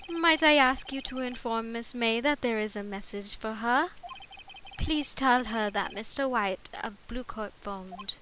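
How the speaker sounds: formal bellboy in amateur British; projected, very proper attempt at British; attempted British accent. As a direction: deliver a message in a put-on British accent. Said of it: "give him a British accent".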